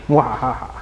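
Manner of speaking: evil laugh. Acted. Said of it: "And he says".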